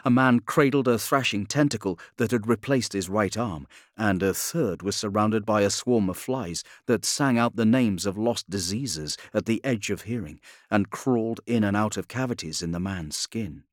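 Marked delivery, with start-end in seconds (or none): none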